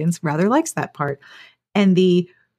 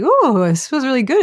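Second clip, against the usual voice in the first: mock dizzy delight; 6 semitones higher, 4 dB louder than usual. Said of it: "be like".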